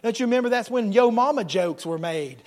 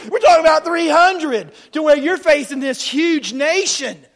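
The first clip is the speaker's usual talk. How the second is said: loud, raised-pitch sermon storytelling; full-force preaching; 8 semitones higher, 10 dB louder than usual. Acted. Preacher recalling Gideon's army, full-force preaching. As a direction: preach it out to rouse the room.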